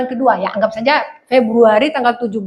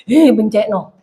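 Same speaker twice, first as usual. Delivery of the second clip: Surabaya Javanese; 5 semitones lower, slower than usual. Retold or imitated. Quoting spouse, Surabaya slang.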